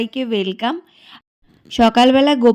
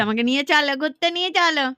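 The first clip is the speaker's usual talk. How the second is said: voicing the baby; 6 semitones higher, 4 dB quieter than usual. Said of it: "মানে কি বলছে".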